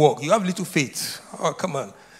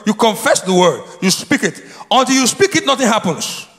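ordinary talk

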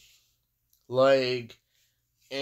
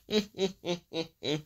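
short real laugh at herself; amused laugh; 5 semitones higher, 4 dB quieter than usual. Real, not acted. Laughing at her own mix-up about the years. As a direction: laugh off the slip.

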